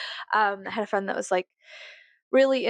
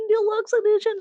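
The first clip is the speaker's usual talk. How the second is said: Deluxe edition pitched high and louder in a put-on voice; mock creepy emphasis; 12 semitones higher, 4 dB louder than usual. Creepy stalker bit, high loud Deluxe edition.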